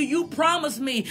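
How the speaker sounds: usual speaking voice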